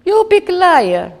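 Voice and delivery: high, loud, slow accusation in broken English; accusing shout. Retold or imitated. Soldier accusing the mother at the border, shouting. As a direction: hurl big liar at her.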